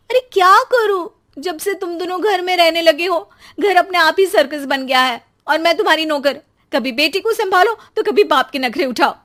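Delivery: loud, high fed-up wife; wife complaining to husband about the household circus; exasperated complaint. Acted. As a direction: vent everything at once.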